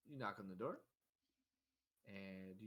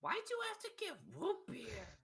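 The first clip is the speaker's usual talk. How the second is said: very high, put-on little kid voice; high-pitched child's grumble; whiny child; 6 dB louder than usual. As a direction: complain like a disappointed kid, high and louder. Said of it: "and goes".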